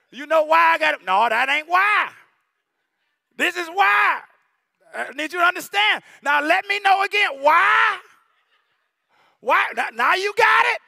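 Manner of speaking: loud stern lecture, punching each why; loud mock parental interrogation. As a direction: drill the lesson into the kid. Parent voiced lecturing child.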